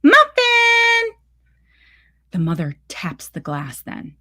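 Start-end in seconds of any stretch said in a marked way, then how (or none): 0.0-1.1 high, carrying call across the lawn; calling the dog. Acted. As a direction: call out to the dog, much higher and louder. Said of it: "the woman calling".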